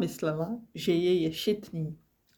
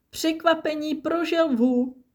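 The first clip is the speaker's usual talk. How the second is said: higher and louder scoffing boom; 10 semitones higher, 4 dB louder than usual. A narrator's reading. Jumbo scoffing at Nimble's plan, booming. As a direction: boom it out mockingly. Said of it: "zaburácel".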